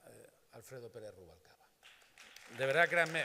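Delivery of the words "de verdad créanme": louder, insist on it; loud emphatic plea. Real, not acted. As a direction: plead your sincerity out loud. (con énfasis, en voz alta)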